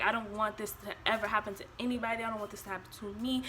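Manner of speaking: ordinary talk, nothing marked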